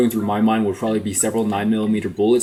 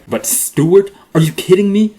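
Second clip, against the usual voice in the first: louder, higher outraged disbelief; 9 semitones higher, 6 dB louder than usual. Real, not acted. Ranting at the wife's baby name, loud outraged disbelief. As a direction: cry out at the absurd name.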